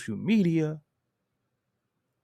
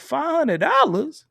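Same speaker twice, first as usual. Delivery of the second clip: slow, louder, higher disbelief; shocked at the price; 10 semitones higher, 6 dB louder, slower than usual. Retold or imitated. Hearing the hotel cost, slow stunned shock. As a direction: stretch it out, stunned by the bill. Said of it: "I said verbatim".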